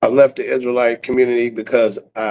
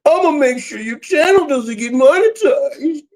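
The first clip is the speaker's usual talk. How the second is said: much higher, whiny put-on voice threatening the channel; mock angry viewer; 14 semitones higher than usual. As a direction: squeak it out like a petty troll.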